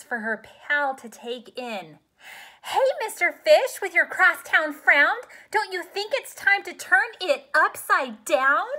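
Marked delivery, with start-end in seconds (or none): 2.6-8.7 much higher, louder cheery clam voice; bright bubbly clam calling out to him; chirpy clam. Acted. Clam cheerfully advising the pouting fish, high and loud. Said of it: "Says the fish to his friend".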